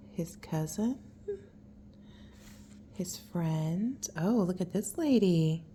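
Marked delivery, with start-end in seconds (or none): none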